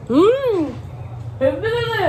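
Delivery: high, drawn-out burst of relish; delighted tasting. Real, not acted. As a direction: savour it with a soaring voice.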